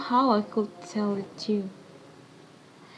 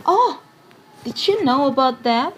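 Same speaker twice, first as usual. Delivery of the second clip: much higher, loud exclamation of surprise; surprised; 5 semitones higher, 9 dB louder than usual. Acted. Elida surprised the visitor knows, high and loud. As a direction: catch yourself off guard.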